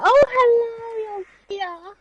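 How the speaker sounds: high slow coo to a pet; sing-song hello to the dog; cooing greeting. Real, not acted.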